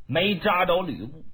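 usual speaking voice

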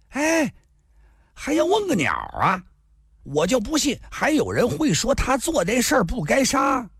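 high-pitched, agitated protest; indignant outburst. A narrator's reading. Pockmarked man objects to asking anyone, indignant outburst. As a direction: jump in, voice shooting up in exasperation. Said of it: "麻子一听跳了起来".

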